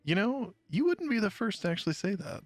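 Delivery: preening at the compliment; smug character voice. Acted.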